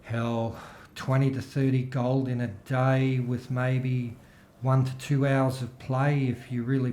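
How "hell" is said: usual speaking voice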